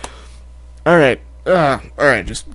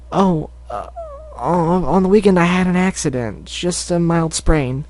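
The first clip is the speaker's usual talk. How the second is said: high shy filly voice; downplay it shyly; 4 semitones higher, slower than usual. Acted. Cherry playing down her sprain, high shy voice.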